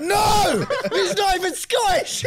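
high, loud laughing shout; hammed-up indignant yell; mock outrage. Outraged at wrong Fergus voice.

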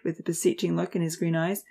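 plain reading voice

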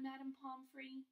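put-on boy coaxing the nurse by name; pleading. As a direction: wheedle the name Madame Pomfrey. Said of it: "Harry pleaded".

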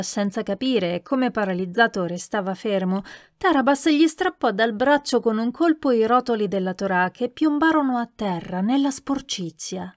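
ordinary delivery, nothing marked

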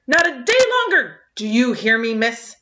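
high, loud scolding; raging at the girl; furious old man. A narrator's reading. Angry farmer scolds Anne, loud and high. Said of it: "Mister Harrison found his voice".

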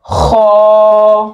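खो with rounded mouth, thick and heavy; heavy rounded kho. Shown as a demonstration. Teacher models a full खो for students. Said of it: "मुंह गोल करके ... मोटा पढ़ेंगे".

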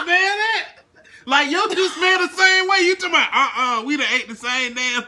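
loud high laughing complaint. Real, not acted. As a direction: laugh through the words. Laughing after bad flavor.

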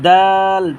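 দাল with a long, level held vowel; drawn three counts. Shown as a demonstration. Teacher models a letter for learners, drawn long. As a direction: stretch the middle of দাল evenly. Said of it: "তিন আলিফ টেনে পড়বো".

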